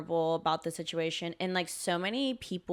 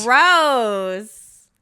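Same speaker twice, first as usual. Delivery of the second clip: very loud, drawn-out higher cry; mock disgust; 4 semitones higher, 12 dB louder than usual. Echoing the co-host about cringey sympathy, hammed-up disgust. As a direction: wail it with exaggerated revulsion.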